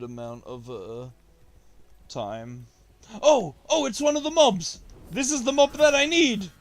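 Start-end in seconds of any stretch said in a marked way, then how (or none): 3.2-6.5 high, loud thrilled yell; excited shout. Real, not acted. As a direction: burst out with excitement. Spots the needed mob.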